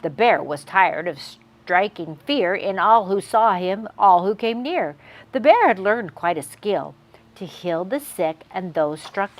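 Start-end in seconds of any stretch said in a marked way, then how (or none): none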